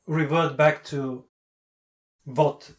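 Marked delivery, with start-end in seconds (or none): 2.2-2.7 "what" pronounced "vot", slow. Retold or imitated. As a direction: mimic the slip, starting "what" with a V. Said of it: "with the V".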